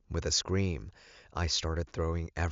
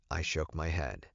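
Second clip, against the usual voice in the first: plain reading voice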